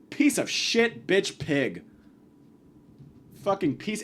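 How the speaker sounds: normal talking voice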